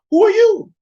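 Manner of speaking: high, loud incredulous demand; old Isaac demanding. Retold or imitated. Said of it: "Look what Isaac said to him".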